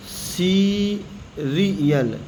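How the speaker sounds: slow सीरियल with S; slow model word. Shown as a demonstration. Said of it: "तो इधर क्या पढ़ेंगे".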